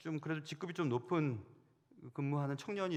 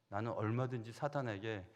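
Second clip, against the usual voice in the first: usual speaking voice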